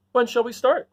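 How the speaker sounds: high, eager Scarecrow. Acted. Scarecrow asking when they start. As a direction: straw man keen to set off. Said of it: "asked the Scarecrow".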